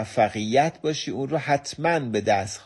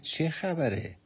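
pitched-up, softer "چه خبره؟" in mild surprise; surprised; 5 semitones higher, 6 dB quieter, slower than usual. Retold or imitated. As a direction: ask the father, puzzled. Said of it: "با تعجب از پدرش پرسید". (پرسش متعجب و آرام)

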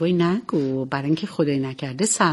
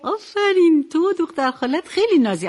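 cheerful praise; 9 semitones higher than usual. Real, not acted. Host praising the child. (تشویق شاد و کشیده)

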